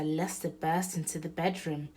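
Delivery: ordinary delivery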